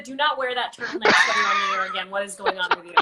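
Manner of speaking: quoting a stern text through laughter; half-laughing mock order; playful scolding read aloud while laughing. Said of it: "you immediately texted me and said".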